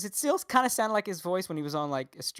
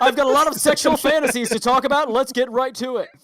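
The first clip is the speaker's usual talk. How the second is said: newscaster dirty talk; 6 semitones higher, 9 dB louder than usual. Retold or imitated. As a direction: shout it like a sleazy news host. Continues dirty-talk newscaster bit.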